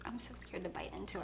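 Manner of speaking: scared whisper. Real, not acted. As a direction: whisper it, afraid to bite. Afraid of rotten egg flavor.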